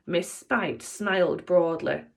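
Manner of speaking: plain reading voice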